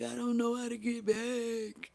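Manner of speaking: high, drawn-out helpless plea; helpless whine. Retold or imitated. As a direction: act out a slow, pleading whine. Retells own helpless plea to friend.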